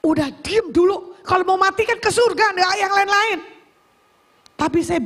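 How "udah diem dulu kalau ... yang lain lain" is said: raised-pitch, insistent comeback; impatient retort. Retold or imitated. Driver hushing the yelling sibling, impatient. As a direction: re-enact silencing a panicky passenger. Snapping back at panicking sibling.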